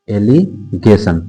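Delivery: "Allegation" slowly split into "एलि" and "गेशन"; slow, two-part "allegation"; drawn-out dictation. Shown as a demonstration. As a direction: pause inside "allegation", as if writing it. Teacher spells out topic slowly.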